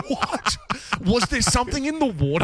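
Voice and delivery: laughing disbelief. Real, not acted.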